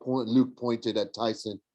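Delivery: ordinary delivery, nothing marked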